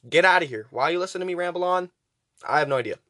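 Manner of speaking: ordinary delivery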